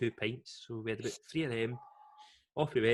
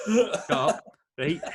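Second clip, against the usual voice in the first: high, loud mock snap; mock yell; 5 semitones higher, 8 dB louder, much slower than usual. To the mocking host, a loud mock shut up. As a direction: bark it at the heckler. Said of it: "him going".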